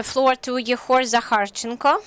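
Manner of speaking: ordinary talk, nothing marked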